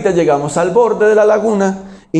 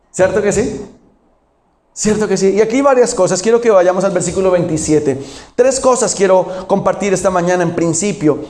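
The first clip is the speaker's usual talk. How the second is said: ordinary talk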